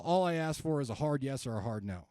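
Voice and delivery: ordinary talk, nothing marked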